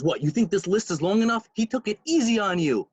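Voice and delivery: high, incredulous telling-off; indignant scolding. Retold or imitated. Rebbe scolding the man who wants a shorter list, indignant. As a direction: challenge him, pitch climbing in mock outrage.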